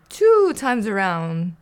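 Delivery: slow gleeful counting. Acted. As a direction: drag it out with glee.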